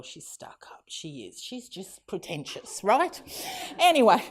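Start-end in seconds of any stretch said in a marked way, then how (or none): 0.0-2.8 hushed, whispery dig at a relative; whispered aside. Real, not acted. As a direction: drop to a whisper, like sharing gossip. Confiding gossip about her aunt.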